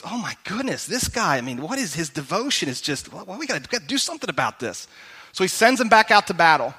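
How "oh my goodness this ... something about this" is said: thinking aloud. Retold or imitated. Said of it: "So David thinks".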